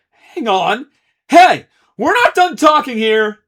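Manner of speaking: high, loud indignant yell; angry protest. Acted. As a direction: shout him down in protest. Ace protests being thrown out.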